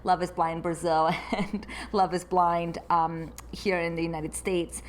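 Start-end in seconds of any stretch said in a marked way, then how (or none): none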